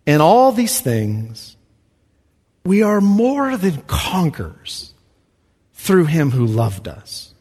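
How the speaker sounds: measured, deliberate reading of the verse; slow scripture reading. Acted. Preacher reading scripture slowly to the church. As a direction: read it slowly and solemnly.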